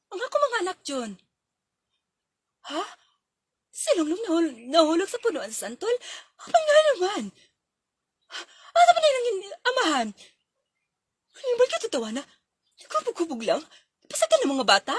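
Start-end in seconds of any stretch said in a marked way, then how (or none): 3.7-14.9 high, loud phone scolding; a mother furious on the phone; angry scolding. Acted. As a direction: scold him for letting the kids fall.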